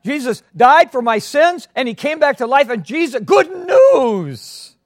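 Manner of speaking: high, loud, slow shout of faith; joyful proclamation. Acted. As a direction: proclaim it like an eager boy.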